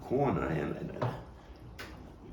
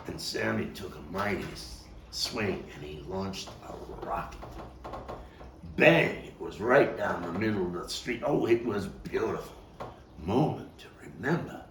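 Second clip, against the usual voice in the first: ordinary delivery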